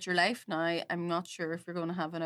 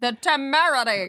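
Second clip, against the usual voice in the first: mock grand; 6 semitones higher, 8 dB louder than usual. Echoing a fancy word theatrically.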